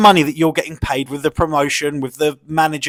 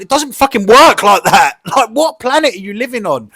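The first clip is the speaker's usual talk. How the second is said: louder, exasperated rant; exasperated outburst; 6 semitones higher, 8 dB louder than usual. Real, not acted. Ranting to viewers about fighters' demands, exasperated loud outburst. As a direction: blurt it out, fed up and loud.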